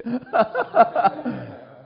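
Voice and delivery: high burst of laughter; real laughter after the punchline; loud laugh. Real, not acted. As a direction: laugh at your own joke. Laughing at own story.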